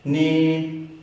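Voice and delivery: "Ni" high and straight; first tone. Shown as a demonstration. Teacher drilling first tone, "Ni" high and level. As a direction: hold "Ni" on one high, level pitch. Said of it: "First tone, high up and straight".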